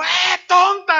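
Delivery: high, loud bratty yell at his mother; child's shout. Retold or imitated. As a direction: snap it out like an angry toddler. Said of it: "le empieza a decir". (grito agudo y fuerte de niño malcriado)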